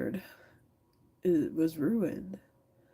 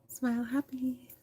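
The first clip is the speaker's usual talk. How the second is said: high-pitched mock happiness; fake cheerful; 7 semitones higher than usual. Streamer acting happy as the critic wants, fake cheerful. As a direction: put on a sing-song show of cheer. Mocks demand to look cheerful.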